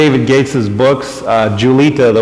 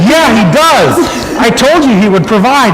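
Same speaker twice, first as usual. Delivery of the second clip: higher, louder, triumphant reply; 9 semitones higher, 4 dB louder than usual. Retold or imitated. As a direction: answer with proud joy.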